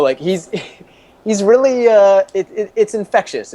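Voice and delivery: ordinary talk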